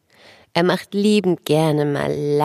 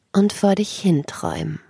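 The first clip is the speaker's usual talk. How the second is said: sleep story narrator. Acted. Guided relaxation for children.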